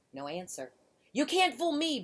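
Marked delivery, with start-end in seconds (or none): 1.1-2.0 uncertain bear growl. A narrator's reading. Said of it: "Bear growled".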